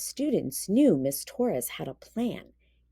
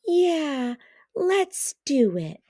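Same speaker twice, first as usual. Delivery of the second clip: excited; 8 semitones higher, 4 dB louder, slower than usual. A narrator's reading. Kids eager for a game.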